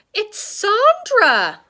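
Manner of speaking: high, loud, drawn-out fan scream; sudden scream. A narrator's reading. Bystander spots a soap star and interrupts, screaming. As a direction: scream the name, stretching it out. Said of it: "someone suddenly screamed".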